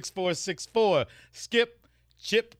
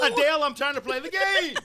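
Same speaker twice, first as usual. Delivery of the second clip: high, loud whiny yell; annoyed gamer whine; 11 semitones higher, 3 dB louder than usual. Acted. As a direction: whine at her to leave you alone. Voicing the gamer dispatcher.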